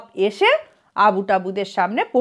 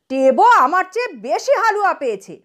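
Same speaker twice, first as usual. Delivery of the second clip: mild narrated yell; 7 semitones higher than usual. A narrator's reading. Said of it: "চিৎকার করে উঠল".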